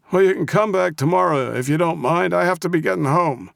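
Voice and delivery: high-pitched, cranky brush-off; crabby old man. A narrator's reading. Old man dismissing the Russian and his car, crabby. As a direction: send them away in a crabby old voice. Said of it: "his best crabby old man voice".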